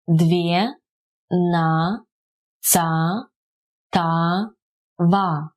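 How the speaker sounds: "две, на, ца, та, ва" slowly, one syllable each. Shown as a demonstration. Teacher repeating the numeral for learners, syllable by syllable. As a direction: leave gaps between "две, на, ца, та, ва".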